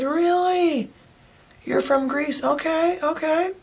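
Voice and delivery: high, soft, drawn-out surprise; mock amazement. Diner learning the waitress is from Greece, high mock surprise. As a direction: play up intrigued surprise in a high, light voice. Re-enacts reacting to waitress's origin.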